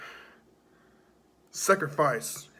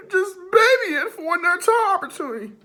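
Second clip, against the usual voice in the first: mocking whine; 5 dB louder, much faster than usual. Mocking whining in promo.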